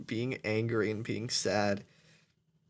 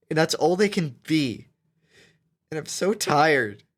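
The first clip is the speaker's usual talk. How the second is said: angry; 2 semitones higher, 8 dB louder than usual. Real, not acted. Furious rant about haters.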